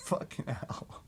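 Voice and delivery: ordinary talk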